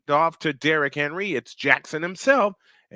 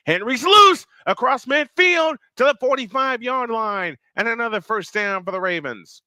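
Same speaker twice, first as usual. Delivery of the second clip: higher-pitched football call, surging on the breakaway; excited play-by-play; 6 semitones higher than usual. Real, not acted. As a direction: cheer the big run on as you call it. Excited live call of a run.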